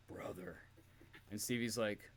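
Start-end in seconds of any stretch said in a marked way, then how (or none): 0.0-0.6 sigh, then whisper "Brother."; a tired old-timer breathing one word; weary sigh and whisper. Retold or imitated. Hogan sighing to Stevie backstage, whispered brother. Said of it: "he just goes".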